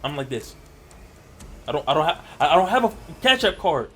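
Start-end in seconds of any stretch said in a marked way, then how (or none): 1.7-3.9 much higher, loud shocked cry; high loud stunned cry; shocked outburst. Retold or imitated. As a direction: blurt out the shock. Shock at finding the card.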